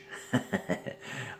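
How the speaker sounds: a soft, voiceless snicker under the breath; secret snicker. Real, not acted. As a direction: giggle quietly like sharing a naughty secret. Giggle after secret hacking claim.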